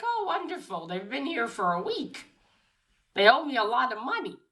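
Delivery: high-pitched innkeeper with a swooping lilt; voiced innkeeper. Innkeeper greets the guests who owe him money, high voiced exclamation. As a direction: exclaim it high, then keep the pitch bouncing. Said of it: "the innkeeper comes down. He's like".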